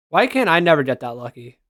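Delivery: normal talking voice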